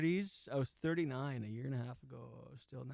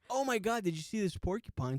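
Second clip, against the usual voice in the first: high-pitched startled buddy; excited exclamation; 5 semitones higher than usual. Retold or imitated. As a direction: blurt it out in amazement. Buddy exclaims at spotting a porcupine.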